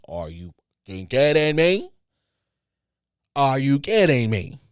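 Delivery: loud, disbelieving outburst; outraged. Real, not acted. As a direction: blow up at the ungrateful guest. Outraged reaction to ungrateful guest.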